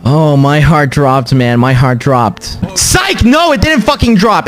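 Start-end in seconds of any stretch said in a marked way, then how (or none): 2.8-4.5 sudden high outburst; spring the reversal on them, pitched high; gleeful fake-out. Real, not acted. Streamer fooling chat about the heartbreak, gleeful fake-out.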